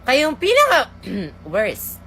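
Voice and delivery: soft, hurt scolding; disappointed teacher. Acted. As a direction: let them feel your disappointment.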